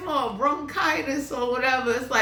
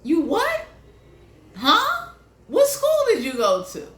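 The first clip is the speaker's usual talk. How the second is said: mock disbelief; 6 semitones higher than usual. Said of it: "Everybody looking at somebody like".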